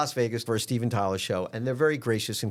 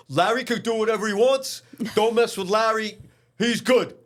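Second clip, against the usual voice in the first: higher, louder mock tough-guy reassurance; imitating the bodyguard; 10 semitones higher, 5 dB louder than usual. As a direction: play the bodyguard vouching for him, hammed up. Retold bodyguard's protective words.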